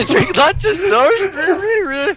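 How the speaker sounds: high voice through laughter; laughing high voice. Acted. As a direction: squeal it while laughing.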